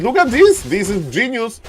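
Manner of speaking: astonished caster; excited. Real, not acted. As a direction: marvel at the clever play. Caster marvels at play.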